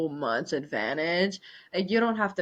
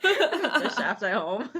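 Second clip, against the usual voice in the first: laughing; 5 semitones higher, slower than usual. Real, not acted. Repeating the joke.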